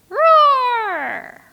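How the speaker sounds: high, loud roar falling away; loud, hammed-up cartoon roar; big lion roar. Shown as a demonstration. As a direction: let out a full lion roar. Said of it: "Simba the lion".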